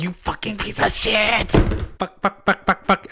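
hammed-up screaming fit of curses; furious swearing Resetti. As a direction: rage at the player like a cheated mole. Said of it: "he'd be like".

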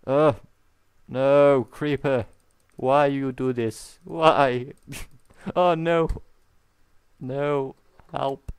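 high, loud panicked cries; panicked yelling. Real, not acted. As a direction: cry out for help. Creeper attack, falling.

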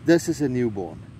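normal talking voice

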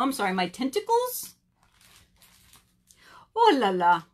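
put-on showy, swooping French accent; preening snail in a silly French accent; terrible French accent. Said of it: "I do a very terrible accent".

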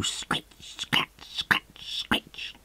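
whispered scratching. Acted. Noise of a hoe.

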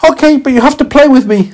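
put-on high, loud tiny boy voice; tiny boy meets a talking carrot, curious and high. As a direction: squeak it out like a small child. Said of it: "said the little boy".

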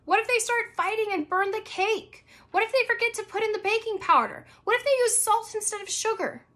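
put-on girl imagining her sisters ruining the cake; worried Abby. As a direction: pile up the disasters like a fretful kid. Abby worrying about sisters.

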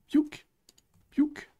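playful high piouk effect; squeaky piouk. Pretend. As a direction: chirp it like a cartoon sound. Sound effect while coding.

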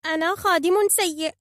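high, loud bird voice; high-pitched childlike self-reproach; self-blaming phoenix. A narrator's reading. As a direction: insist miserably on your fault. Dejected phoenix blames himself.

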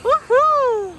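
high joyful woohoo; loud happy whoop; excited cheer. Real, not acted. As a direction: cheer the dog on with delight. Cheering the dog on.

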